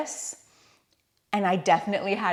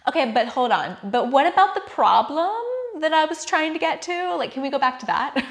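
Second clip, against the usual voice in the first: impatient mind; 8 semitones higher, 5 dB louder, faster than usual. Retold or imitated. Mimics own impatient mind mid-ceremony.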